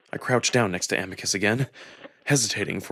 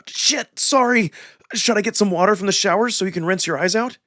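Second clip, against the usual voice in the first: much higher, rushed and flustered; alarmed apology; 10 semitones higher, 3 dB louder than usual. A narrator's reading. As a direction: blurt it out, suddenly guilty and worried. Realises soap stung his eyes.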